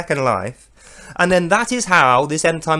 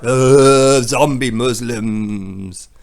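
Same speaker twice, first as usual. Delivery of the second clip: low, very slow groaning voice; hammed-up zombie moan; mock zombie; 6 semitones lower, 4 dB louder, much slower than usual. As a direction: drag it out like the undead. Imitating zombies.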